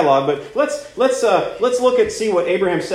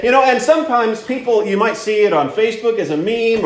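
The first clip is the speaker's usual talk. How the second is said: ordinary delivery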